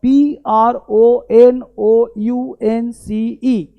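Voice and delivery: letters spelled slowly one by one; slow spelling out. Shown as a demonstration. Teacher spelling out a word slowly for students. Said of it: "देखो लिखता हूँ".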